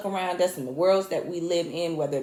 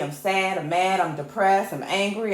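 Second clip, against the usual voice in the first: ordinary talk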